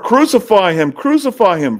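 high, loud mob cry of crucify him; crowd crying out. Acted. Preacher voicing the mob crying for crucifixion, high and loud.

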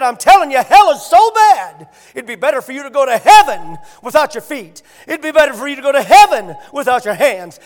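loud, impassioned pulpit shout; full-force preaching. Acted. Preacher warning the congregation about hell, shouting loudly.

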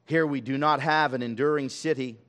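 ordinary talk, nothing marked

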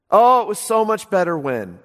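mock nostalgia. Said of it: "No, it wasn't".

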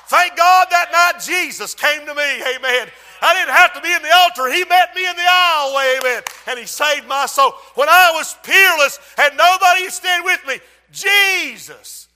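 loud, high, jubilant pulpit shout; full-force preaching. Real, not acted. Preacher thanking God for saving him, full-force preaching. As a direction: testify at the top of your voice.

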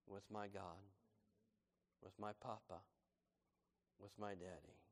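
soft, low and tender; tender hush. Real, not acted. Preacher describing quiet prayer with God, soft and tender. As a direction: murmur it like a secret to a loved father. Intimate time alone with God.

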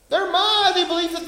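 high, loud scoffing cry; mocking shout of the scoffers. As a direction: hurl it like a scoffer's jeer. Preacher mimics scoffers, shouting.

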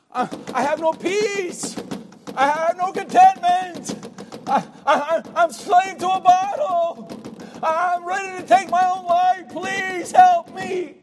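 slow, high, loud anguished begging; desperate plea. Acted. A lost person crying out to the church, desperate plea. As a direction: cry out like someone at the end of their rope. Lost soul begs for help.

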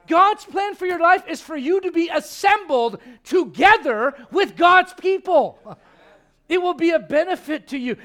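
loud, building sermon delivery; preaching at full force. Acted. Pastor telling the church to gather, in a loud preaching voice. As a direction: preach it out to the pews.

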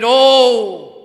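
a high, loud, drawn-out anguished oh; loud cry of oh. Acted. Preacher acting the Lord's exclamation, loud high anguished oh. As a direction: cry out the oh from the heart. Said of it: "and he's crying out".

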